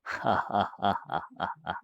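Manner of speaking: soft, low rising laugh; a ghost savouring the kill; evil cackle. Acted. (मौत पर हँसता भूत, धीमी शैतानी हँसी)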